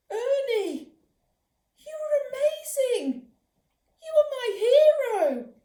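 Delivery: high, loud awestruck praise; amazed gushing. Acted. Mum praising Ernie after the robbery. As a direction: gush over your hero.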